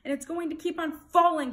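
loud, high, quick outburst; shouting. A narrator's reading. Bartholomew loses patience with the king, shouting. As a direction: shout it, unable to hold back. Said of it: "he shouted".

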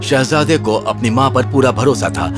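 usual speaking voice

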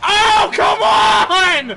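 high, loud, slow drawn-out complaint; exasperated shout. Real, not acted. Streamer shouting at a missed shot, exasperated. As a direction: wail the frustration long and loud.

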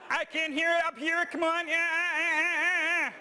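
very high and loud, laughing frog voice; Kermit voice. Shown as a demonstration. Presenter urges audience on in a high, loud Kermit voice. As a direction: coax them up there in Kermit's voice. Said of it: "I wanna hear the sound right up here".

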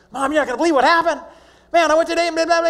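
blurting news to his mom; excited boy. Acted. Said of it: "he would just spill it all".

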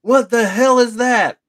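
high, loud startled yell; yelled alarm. Retold or imitated. Parent yelling at strange lights, alarmed yell. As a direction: shout it in disbelief across a yard. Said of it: "one of the parents yells, like".

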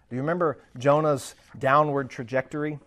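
ordinary delivery

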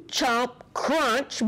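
ordinary delivery, nothing marked

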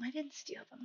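whispered denial, barely voiced; guilty whisper. Real, not acted. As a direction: hush it like a guilty secret.

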